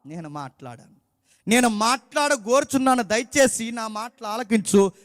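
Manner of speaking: solemn, deliberate scripture recital; solemn scripture reading. Acted. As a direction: read the verses like a pulpit proclamation. Reads Job 42 aloud.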